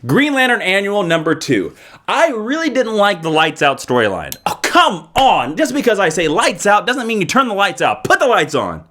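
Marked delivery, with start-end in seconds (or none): 4.4-8.8 exasperated scolding. Pretend. Scolds crew after lights go out.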